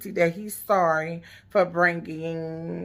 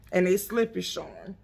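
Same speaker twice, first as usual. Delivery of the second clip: ordinary talk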